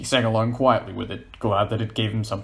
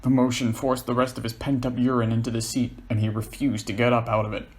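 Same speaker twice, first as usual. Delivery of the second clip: usual speaking voice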